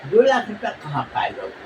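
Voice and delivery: a father wondering where the money came from; a hint of a sceptical father; father's doubting voice. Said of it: "अब उसका बाप बोला".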